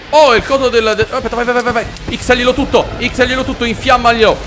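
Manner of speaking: high-pitched, hyped cheering; excited egging-on. Real, not acted. Player egging character on to burn it, excited.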